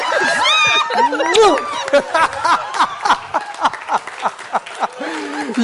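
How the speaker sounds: long high laughing whoop; shouted laughter; ecstatic laughing shout. Real, not acted. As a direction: let a big joyous laugh ring out.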